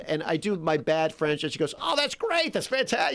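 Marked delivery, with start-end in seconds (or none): 1.8-3.1 high-pitched gushing praise; gushing director. Retold or imitated. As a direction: imitate a director delighted by a poor audition. Retold director praising his bad French.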